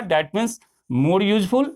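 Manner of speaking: slow teacher emphasis. Shown as a demonstration. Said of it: "teaching the comparative form".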